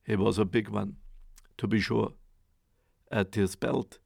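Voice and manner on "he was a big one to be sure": plain reading voice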